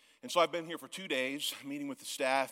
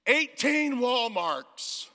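very slow, loud, high; exasperated punchline; 11 semitones higher, 9 dB louder, much slower than usual. Real, not acted. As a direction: drag it out in mock dismay.

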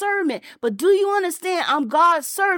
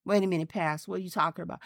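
much lower and much softer, a hushed skeptic; doubting churchgoer; 9 semitones lower, 10 dB quieter, much faster than usual. Retold or imitated. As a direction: murmur the objection like a doubting listener in the pews. Preacher voices a doubting listener.

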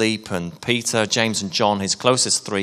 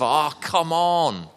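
slow, drawn-out higher exasperation; exasperated sigh; 5 semitones higher, slower than usual. Retold or imitated. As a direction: let the frustration spill out slowly. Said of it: "this tension of frustration".

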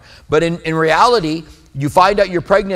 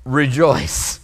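gently; 4 semitones lower than usual. Shown as a demonstration. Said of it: "I always very gently say".

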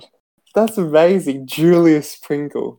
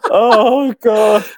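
loud exclamation; 7 semitones higher, 5 dB louder than usual. Real, not acted.

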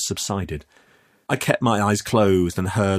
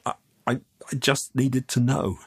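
higher, hesitant and nervous; stammering; 6 semitones higher than usual. Acted. Youth explains himself to the man, stammering. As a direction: falter before getting it out. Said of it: "I stammered".

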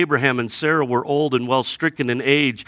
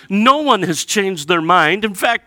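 ordinary talk, nothing marked

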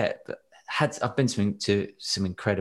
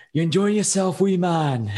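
put-on higher, louder thick Scottish accent; [name], thick Scottish; 9 semitones higher, 5 dB louder than usual. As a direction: call out in a broad Scottish accent. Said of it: "He's in this thick Scottish accent, went".

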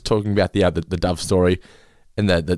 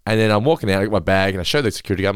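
ordinary delivery

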